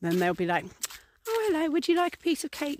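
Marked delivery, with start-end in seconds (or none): 1.3-2.8 put-on toddler voice. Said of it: "if you watch toddlers doing a play".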